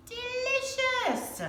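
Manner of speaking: pretending to taste the silly soup, high and slow; pretend delight. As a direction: savour the word like a tasty treat.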